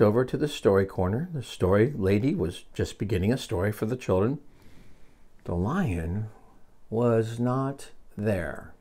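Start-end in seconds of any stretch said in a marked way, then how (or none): 5.4-8.7 soft, slow, paused storytelling; subdued narration. A narrator's reading. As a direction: let the absence sink in slowly. Sad turn in the story.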